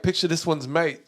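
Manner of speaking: soft, lightly British "mate"; butchered British accent; a hint of a Londoner. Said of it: "butchering the accent".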